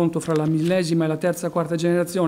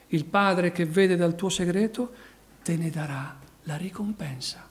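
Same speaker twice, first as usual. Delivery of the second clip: ordinary delivery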